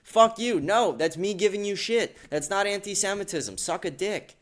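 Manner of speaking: high-pitched, heated rebuttal; angry retort. Real, not acted. Retorting to an imagined accuser, heated and indignant.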